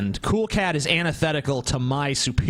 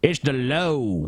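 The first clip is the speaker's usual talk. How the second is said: Southern drawl impression; 3 semitones lower, slower than usual. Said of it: "the way he talks is".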